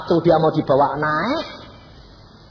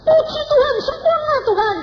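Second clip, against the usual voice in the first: very high, loud shriek of joy; hammed-up screaming praise; screamed praise; 6 dB louder than usual. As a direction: shriek it out in acted rapture. Acts mama's screamed praise.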